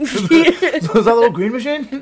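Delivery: high, loud laughing tease; giggling mock nickname; laughing mock question. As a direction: squeal it through laughter.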